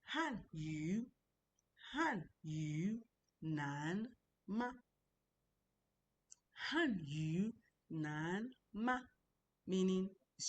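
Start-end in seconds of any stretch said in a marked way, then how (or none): 0.0-9.0 "Han yu nanma" broken up and very slow, "yu" dipping down then up; third-tone demonstration. Shown as a demonstration. Teacher pointing out the down third, slow tone demonstration. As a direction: stretch each tone of "Han yu nanma".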